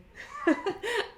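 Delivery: sudden high giggle at herself; embarrassed laugh. Real, not acted. Knitter laughing at her own French pronunciation, high embarrassed giggle.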